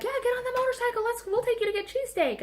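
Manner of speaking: high-pitched put-on biker voice; motorcyclist. Motorcyclists inviting her along for cheesesteak, hammed-up high voice. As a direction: mimic eager bikers way up high. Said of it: "And they were like".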